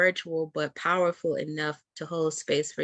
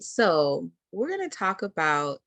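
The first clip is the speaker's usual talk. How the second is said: normal talking voice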